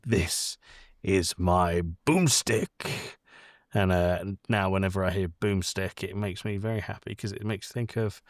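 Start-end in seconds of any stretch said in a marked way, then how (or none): 0.1-3.2 slow, low hammed-up hero line; Ash quote. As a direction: drawl it out like a cocky action hero.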